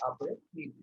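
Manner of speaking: "après-midi" with the s silent, as apremidi. Shown as a demonstration. Teacher repeats the word for students, s left out. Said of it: "S is not pronounced".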